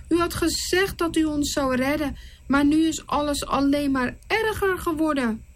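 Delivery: understated sorrow; mildly sad Moses. Acted. Moses complaining to the Lord, mildly sad. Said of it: "Verdrietig gaat Mozes".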